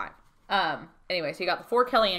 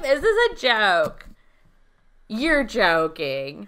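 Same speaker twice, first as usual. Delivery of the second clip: slow, loud melodramatic disbelief; laid on thick; 3 semitones higher, 7 dB louder, much slower than usual. Retold or imitated. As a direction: stretch the words in mock shock. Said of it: "She lays it on so thick".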